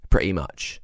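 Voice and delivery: usual speaking voice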